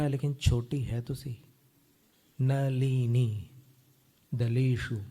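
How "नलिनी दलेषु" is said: slow careful recitation. Shown as a demonstration. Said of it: "teacher reciting slowly".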